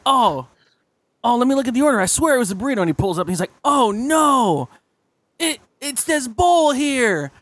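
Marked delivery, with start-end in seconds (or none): 0.1-2.8 high server voice. Retold or imitated. Server checks the order. 3.6-7.3 high, slow dismayed server voice; a high, drawn-out server dismayed at the mix-up. Retold or imitated. Server realizes the mistake.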